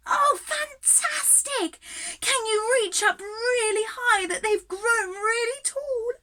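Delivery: high, loud, delighted encouragement; excited cheering. Real, not acted. Teacher cheers the children growing into flowers, high and delighted. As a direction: cheer them on to stretch tall.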